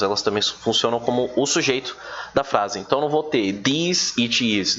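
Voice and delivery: ordinary talk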